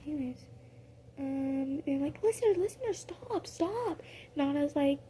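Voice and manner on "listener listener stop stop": high, urgent voiced friends; high pleading. Friends pulling the listener off the fight, high and urgent. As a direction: plead for her to stop.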